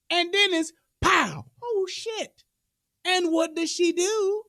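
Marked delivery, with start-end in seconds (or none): none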